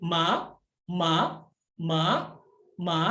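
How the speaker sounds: MA as a rising Mandarin second tone. Shown as a demonstration. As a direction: start MA low and sweep it upward. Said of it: "nada dua itu".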